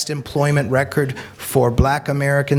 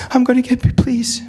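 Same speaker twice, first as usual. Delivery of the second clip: higher, begging; plead, high; pleading girl; 11 semitones higher than usual. Retold or imitated. The girl begs the speaker again, higher and urgent. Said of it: "And I'm like".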